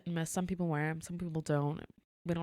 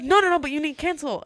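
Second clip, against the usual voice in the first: high, loud imitation of a pushy customer; insistent protest; 10 semitones higher, 11 dB louder than usual. Guest demanding manager cancel her booking, loudly insistent. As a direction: cut in with raised, high mock protest.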